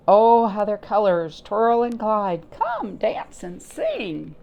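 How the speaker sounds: a playful call to dance; delighted grasshopper. Acted. Teacher voicing the grasshopper for the class, swooning.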